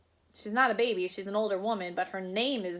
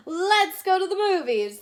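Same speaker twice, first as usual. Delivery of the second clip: higher and louder, bouncing; eager suggestion; 8 semitones higher, 7 dB louder than usual. Acted. Husband urging wife out, eager and loud. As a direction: pitch the plan with glee. Mister Watson proposes outing.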